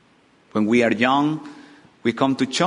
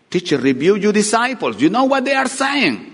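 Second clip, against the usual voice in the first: indignant, climbing high to the end; indignant demand; 9 semitones higher, 4 dB louder than usual. Acted. Pharisees demanding Jesus silence his disciples, indignant. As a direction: rebuke with rising, high-pitched outrage.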